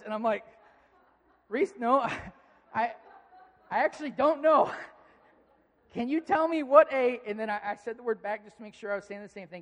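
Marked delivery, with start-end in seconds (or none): 1.5-7.2 high, loud, laughing protest; halting, amused disbelief; flustered laughing. Retold or imitated. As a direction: plead ignorance through laughter. Dad flustered, laughing in retelling.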